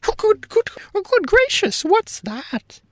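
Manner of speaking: very high, loud startled cry; very high, loud, startled gnome king; startled yell. A narrator's reading. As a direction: jump out of your seat with the words. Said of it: "he yelled".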